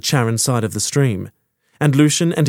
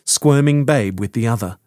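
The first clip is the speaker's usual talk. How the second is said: usual speaking voice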